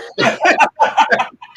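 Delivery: big burst of real laughter; loud laugh. Real, not acted. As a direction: let a loud laugh break out. Laughing at the memory.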